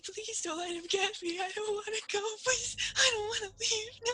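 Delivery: put-on high, terrified girl pleading through tears; scared child's whimpering plea; crying, shaking. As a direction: beg tearfully, pitch climbing in panic. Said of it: "She's crying and she's shaking".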